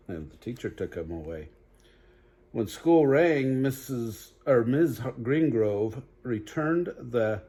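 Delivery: lively storybook read-aloud; animated bedtime story voice; reading to children. Acted. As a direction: read it bright for little listeners. Reads picture book aloud.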